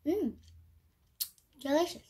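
chewing while talking; muffled by food; mouth full. Real, not acted. Seen 0: Holds sweet at lips, mouth closed and working, then speaks.

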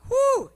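a high, loud whew of relief. Real, not acted. Preacher to congregation, a high, loud whew. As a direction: blow out a sharp, high whew. Preacher exhales after a story.

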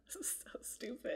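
sheepish whisper; admitting the story is silly; whispered aside. Real, not acted. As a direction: breathe it under your breath, embarrassed. Embarrassed aside mid-story.

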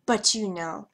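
ordinary talk, nothing marked

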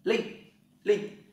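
linh said high and loud, twice; Mandarin reading. Shown as a demonstration. Teacher showing the Mandarin reading linh. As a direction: model the Chinese sound linh, high and clear. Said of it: "tiếng Trung nó đọc là".

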